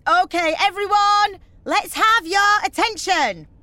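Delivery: loud, high, drawn-out announcement; calling for attention. Acted. Organiser addressing the mums on the bus, calling out. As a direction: project to the back of the bus.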